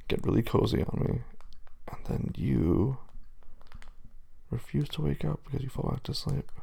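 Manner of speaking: soft whisper, slowing down. Real, not acted. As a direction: hush it like a secret. Softly teasing sleepy partner.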